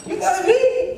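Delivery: very high squealed question; utter disbelief. Retold or imitated. Son floored by father's love, utterly incredulous.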